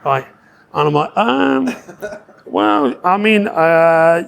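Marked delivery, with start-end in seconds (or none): 1.2-4.2 drawn-out, stalling filler words; mock hesitation. Interviewer cornered by a loaded question, stalling. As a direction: act out searching for an answer, slowly.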